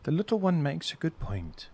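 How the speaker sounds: lightly voiced woman giving way; conceding woman. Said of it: "And she kinda goes".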